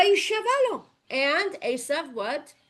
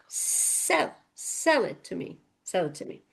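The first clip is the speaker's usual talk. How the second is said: "Sell" leaned on each time, the opening "Sell" breathy and hushed; stress on SELL; 7 semitones lower, 5 dB quieter than usual. Shown as a demonstration. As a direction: press hard on every "Sell". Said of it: "Why am I emphasizing this?".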